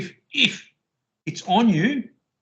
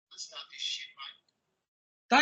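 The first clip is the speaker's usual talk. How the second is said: hushed mock threat; 5 semitones lower, 9 dB quieter, much slower than usual. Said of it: "That's how they talk down here".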